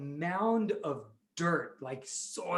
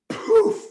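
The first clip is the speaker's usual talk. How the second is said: high, loud "poof"; sudden high-pitched poof; magic poof; 10 dB louder than usual. Pretend. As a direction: burst it out like a magic puff. Magic effect in story.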